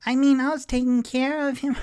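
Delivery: put-on squeaky, fast little girl; child voice. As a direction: play the excited kid defending herself. Child answering mother.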